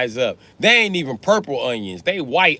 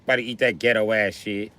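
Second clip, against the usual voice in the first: usual speaking voice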